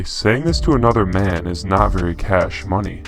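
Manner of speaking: usual speaking voice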